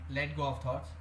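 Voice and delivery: normal talking voice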